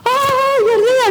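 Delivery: high, drawn-out joyful cry; relieved recognition. Retold or imitated. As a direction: gasp out the joy of spotting him. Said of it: "it was like recognition".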